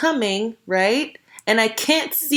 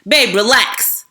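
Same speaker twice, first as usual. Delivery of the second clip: mock harsh calming; 3 dB louder, slower than usual. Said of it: "your version of calming Shelly down".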